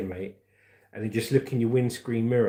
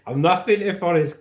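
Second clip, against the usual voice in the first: punched out, louder and higher; emphatic declaration; 7 semitones higher, 8 dB louder than usual. Real, not acted. Streamer proclaiming his catchphrase to viewers, loud and high. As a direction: announce it proudly to the chat.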